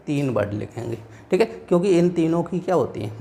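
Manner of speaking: normal talking voice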